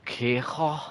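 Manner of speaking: a light, bad Scouse Kiko; Scouse accent. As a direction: say "Kiko" with a Liverpool lilt. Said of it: "no disrespect to the Scouse accent".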